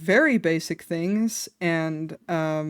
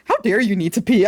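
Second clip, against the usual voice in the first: mock outrage; 6 dB louder, faster than usual. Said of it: "That's exactly what she was like".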